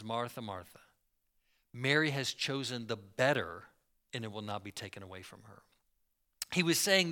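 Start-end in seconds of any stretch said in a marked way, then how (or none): 0.0-5.6 low, soft, gentle reproof; tender, loving. Retold or imitated. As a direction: correct her lovingly, voice dropped low. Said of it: "he says it so lovingly".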